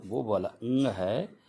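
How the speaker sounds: ordinary delivery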